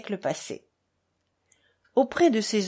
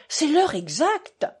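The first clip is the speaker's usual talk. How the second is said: a theatrical, higher-pitched exclamation; exclaiming; 7 semitones higher, 3 dB louder than usual. A narrator's reading. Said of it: "s'écria Fiodor Pavlovitch".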